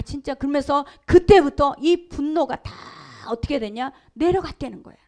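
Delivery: ordinary delivery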